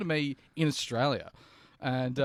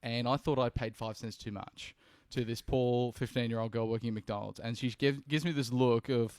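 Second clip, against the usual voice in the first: normal talking voice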